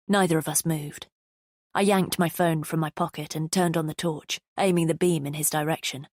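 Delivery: plain reading voice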